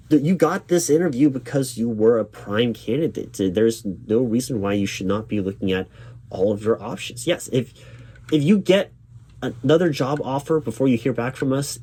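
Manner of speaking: ordinary delivery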